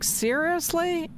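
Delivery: drawn-out, high-pitched incredulous question; retold disbelief. Host reacting to the nurse's hospital story, incredulous.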